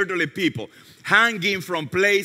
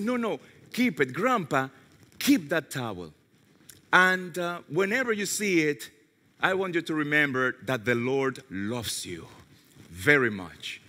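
tender farewell; 5 semitones lower, 7 dB quieter than usual. Retold or imitated. Tender words to crying grandpa.

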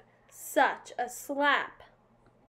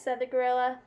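plain reading voice

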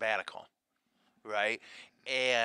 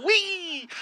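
mock whee; 8 dB louder than usual. Joking about propeller hat.

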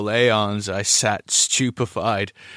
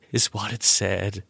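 usual speaking voice

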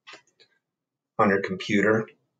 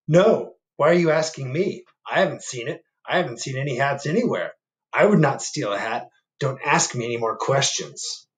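a touch higher, defensive fox; lightly voiced fox; 4 semitones higher, 4 dB louder than usual. Fox brushing off the bear's question, a little defensive. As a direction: deny it a bit too quickly. Fox denies seeing hat.

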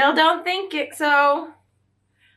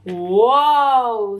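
amazed whoa; 4 dB louder than usual. Acted. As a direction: let the whoa fall open in wonder. Gecko amazed.

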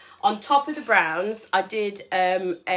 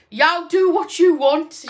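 slow, loud, higher playful put-on accent quoting a friend; mock friend's drawl; 8 semitones higher, 9 dB louder, slower than usual. Vlogger imitating her friend's carefree reply, loud, slow mock accent. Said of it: "She's not Brummy, really".

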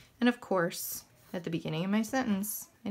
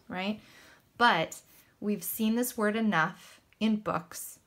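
ordinary talk, nothing marked

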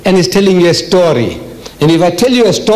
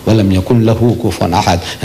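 Quran recitation; 9 semitones lower, much faster than usual. Acted. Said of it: "he doesn't talk like this".

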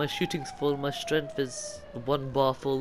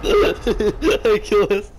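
slow, laughing through the words; 5 semitones higher, 7 dB louder, much slower than usual. Real, not acted. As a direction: crack up while saying it. Laughing after dying in game.

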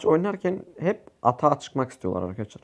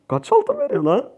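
much higher, incredulous; asking in pure disbelief; amazed question; 10 semitones higher than usual. Real, not acted. Gamer shocked at the gold reward: high incredulous question.

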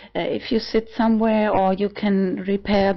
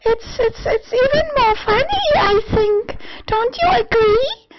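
much higher, louder, a squeaky innocent little voice; high cutesy voice; 4 dB louder than usual. Shown as a demonstration. Vlogger showing off her high cute kitty voice. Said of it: "then you talk like this".

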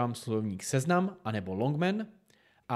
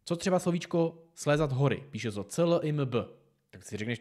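ordinary talk, nothing marked

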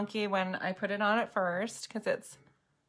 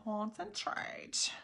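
"Concentrate" whispered very slowly with a rise; whispered weird voice; 10 dB quieter, much slower than usual. Pretend. Makeup streamer clowning while focusing, slow whisper. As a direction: breathe the word out slowly, voiceless. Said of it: "I feel like doing some weird voices tonight".

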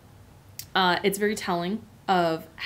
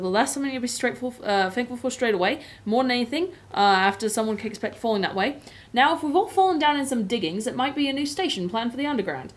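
hearty South London cabbie voice; a Cockney cabbie, cheerful and firm; South London accent; 4 semitones higher, 3 dB louder, faster than usual. Acted. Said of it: "I will read in a South London accent".

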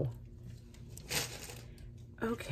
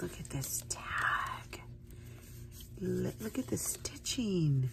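hushed, slow whisper; 6 dB quieter, slower than usual. Real, not acted. Admiring the tag, whispering.

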